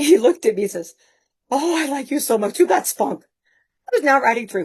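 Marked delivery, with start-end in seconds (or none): 1.5-3.2 high-pitched, admiring trainer voice; imitated trainer. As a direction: play up his delight, pitched high. Imitating trainer's approval again.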